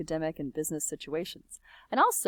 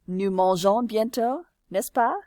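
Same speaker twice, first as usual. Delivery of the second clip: high rising tag on n'est-ce pas; tag question intonation; 3 semitones higher, 6 dB louder than usual. Shown as a demonstration. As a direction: lift the voice sharply on n'est-ce pas. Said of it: "end a statement with a tag question".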